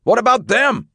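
high, loud demanding shout; yelled question. Acted. Eagle asking about the city crew, loud yell. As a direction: shout it across the fire. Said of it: "Eagle yelled".

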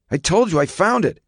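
high, insistent protest; defensive teenage son. A narrator's reading. Son insisting he found it, defensive and high. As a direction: push back in a high, exasperated son's voice.

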